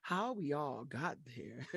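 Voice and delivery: low, slow and soft. Shown as a demonstration. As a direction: drop into a low, slow tenor. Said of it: "it has that cadence of the".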